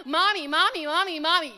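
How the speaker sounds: loud, high, nagging Mommy calls; insistent calling. Retold or imitated. Child in the back seat nagging mommy, loud and insistent. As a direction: pester mom over and over.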